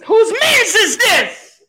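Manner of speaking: high, slow laughing scream. Pretend. Contest of screaming the show name.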